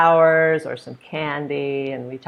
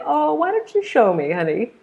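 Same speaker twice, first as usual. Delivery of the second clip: high, lilting sweet talk, dropping on honey; indulgent adult to child; 9 semitones higher, 4 dB louder than usual. Acted. Teacher asking a little girl to show her, indulgent. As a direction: humour the little one in a high voice. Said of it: "I'd say".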